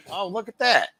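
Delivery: high, airy "oh, look at that" in a put-on voice; mock surprise. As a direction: play up the wonder. Mock reaction to the camera.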